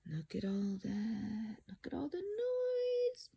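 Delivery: soft hushed aside; hushed wonder at the picture; whisper. Real, not acted. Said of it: "reader whispering to listener".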